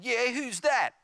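high, taunting brother voice; mocking challenge. Brother challenging his upset sibling, mocking challenge. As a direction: throw it back as a jeering question. Brother sneers back in quarrel.

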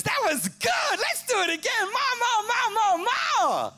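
high, loud greedy brain voice; brain's voice. Retold or imitated. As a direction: shout it like a greedy craving brain. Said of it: "your brain goes".

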